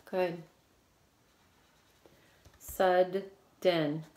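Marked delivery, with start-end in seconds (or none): 2.6-4.0 "Sudden" slowly split, a clear gap between Sud and den; syllable by syllable. Shown as a demonstration. Teacher showing pupils where to split it, "Sudden" in two separate parts. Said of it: "Where are you gonna split it?".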